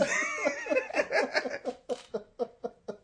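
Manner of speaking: long, very high wheezing laugh; real helpless laughter. Real, not acted. Teller can't finish about his video, helpless laughter. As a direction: laugh until no words come out.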